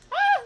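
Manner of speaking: very high, loud shriek; real cry of alarm; startled scream. Real, not acted. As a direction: yelp suddenly at full volume. Startled during play.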